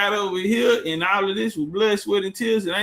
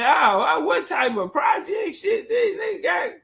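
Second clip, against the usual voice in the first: incredulous yell; 8 semitones higher than usual. Retold or imitated. To the captain about his weed eater motor, a high incredulous yell. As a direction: shout it in disbelief at the homemade boat. Retelling his shock at the boat.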